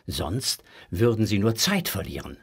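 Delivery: plain reading voice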